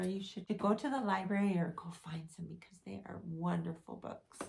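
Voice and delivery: plain reading voice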